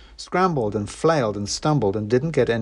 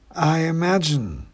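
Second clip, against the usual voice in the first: snooty bored cat; 8 semitones higher, slower than usual. A narrator's reading. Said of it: "said the cat".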